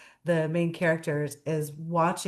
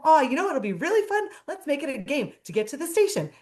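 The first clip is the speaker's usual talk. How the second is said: much higher and fast, eager game pitch; playful Joy voice; 8 semitones higher, faster than usual. Retold or imitated. Joy turns the trip to the station into a game, high and quick. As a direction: sell the fun idea like a cheerleader. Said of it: "She's laughing with him".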